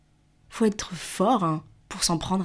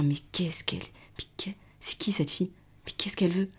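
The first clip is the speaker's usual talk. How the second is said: hushed, whispered confusion; muttering among themselves; 5 dB quieter than usual. Retold or imitated. Officials exchanging looks about this girl, puzzled whisper. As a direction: whisper it like baffled onlookers conferring.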